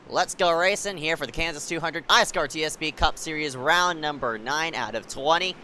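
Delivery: play-by-play. Real, not acted. Race start call.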